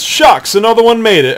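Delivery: a hint of a grudging God snapping his fingers; mock disappointed God. Preacher voicing a grudging God when a sinner squeaks in, mild mock disappointment. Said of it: "he almost snaps his fingers and says".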